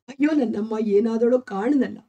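plain reading voice

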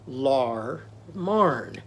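"Lar marn" spaced syllable by syllable; vowels swapped to "ar". Shown as a demonstration. Teacher leads children repeating, drawn-out "Lar marn". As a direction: give "Lar marn" two long "ar" sounds. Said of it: "Can you do that?".

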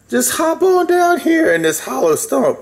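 high-pitched, coaxing invitation; enticing call pitched well up; coaxing stranger. A narrator's reading. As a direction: lure them in with a high voice. Hidden bear luring rabbit into stump.

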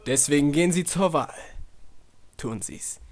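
ordinary talk, nothing marked

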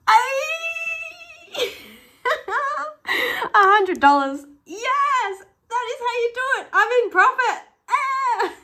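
very high, loud, laughing with joy; thrilled shriek. Real, not acted. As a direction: squeal over a big win. Wins a hundred dollars.

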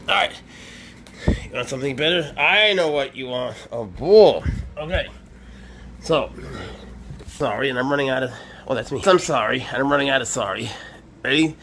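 high, sing-song voice for a pet; pet talk. Real, not acted. Keeper coaxing his pet snake Swaggers, high cooing.

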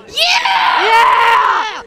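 high, long-held "Yeah!"; cheering yell. Real, not acted. Interviewer joining a boast about diablos, cheering loudly. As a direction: whoop it loud and drawn out.

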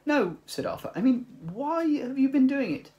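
high, loud exasperated correction; Arthur insisting. Acted. Arthur correcting the mice, high and loud. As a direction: push the point, a little exasperated.

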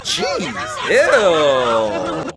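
ordinary talk, nothing marked

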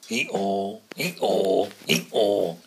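very loud braying call; donkey call. Acted. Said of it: "he calls".

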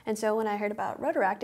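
normal talking voice

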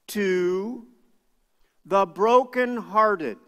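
slow, deliberate reading of a verse; scripture reading. Real, not acted. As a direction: let each word of the verse land. Reads Psalm verse slowly.

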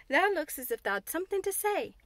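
put-on curious Yorkshire servant girl; Yorkshire maid Martha. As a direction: Martha probing Mary, light Yorkshire. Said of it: "she asked".

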